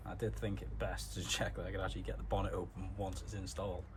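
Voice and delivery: usual speaking voice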